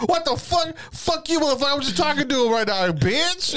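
very high, mock-furious woman's tirade; retold cussing out. Woman caller cursing prankster, high mock fury. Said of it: "she starts cussing me the fuck out".

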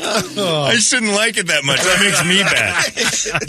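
high, slow, through laughter; laughing mock guilt. Real, not acted. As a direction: pretend guilt while laughing.

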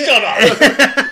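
loud, high yell breaking into laughter; mock angry shout. Star snapping at the girl, mock angry shout. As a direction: yell it as an exaggerated angry outburst. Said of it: "just gets angry about it".

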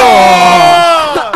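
loud laugh. Real, not acted. Loud laugh after bit.

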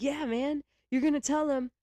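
high, slow, dreamy hippie voice; a blissed-out hippie preaching slowly. Retold or imitated. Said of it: "hippie voice".

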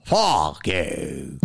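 slow, drawn-out Delco put-down; mock Philly heckler with a Delco accent. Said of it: "fucking Delco accent".

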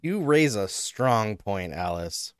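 a put-on serious nod; mock solemn. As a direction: concede it with fake gravity. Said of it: "mock solemn concession".

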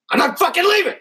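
high, very loud movie-speech yell; emphatic defiant shout. Acted. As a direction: roar it out like a rallying speech. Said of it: "put more emphasis on that".

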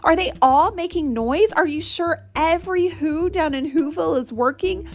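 high-pitched, urgent elephant; calling back. A narrator's reading. Horton presses the mayor about anyone shirking, calling back. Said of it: "Horton called back".

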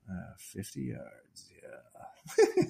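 soft, slow aside; muttering to himself. Retold or imitated. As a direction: mutter it slowly under your breath. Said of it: "And then to himself".

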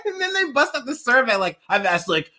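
high, laughing voice. Real, not acted. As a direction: tell it while cracking up. Laughing while telling story.

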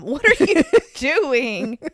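slow, loud, incredulous laughing question; exasperated disbelief. Retold or imitated. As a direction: drag it out in amused disbelief. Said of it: "I was like".

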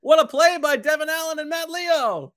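high, loud cheer for the players; fired up. Real, not acted. Color commentator hailing the fumble recovery, fired up. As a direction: holler it, thrilled by the play. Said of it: "I'm too fired up".

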